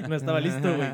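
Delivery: normal talking voice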